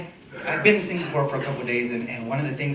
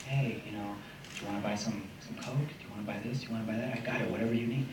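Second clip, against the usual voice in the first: hushed dealer pitch; 4 semitones lower, 12 dB quieter, faster than usual. Retold or imitated. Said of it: "he's just like".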